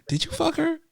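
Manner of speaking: high stunned disbelief; shocked. Real, not acted. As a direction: blurt it out, floored. Shocked question to friend.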